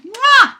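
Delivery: big smacking mwah, very high; a hammed-up cartoon kiss; loud, high kissing sound. Bear kissing another bear.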